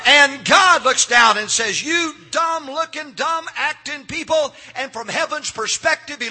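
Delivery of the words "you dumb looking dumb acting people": drawn-out, higher-pitched scolding; slow, lofty put-down from on high; scornful God. Acted. God speaking down to people.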